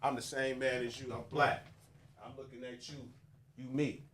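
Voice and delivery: hushed, unhurried brotherly talk with pauses; tender reassurance. Shown as a demonstration. Man telling a brother they share the same struggle, tender reassurance. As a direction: speak softly and intimately, as to one person.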